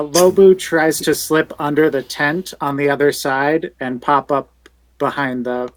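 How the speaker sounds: ordinary talk, nothing marked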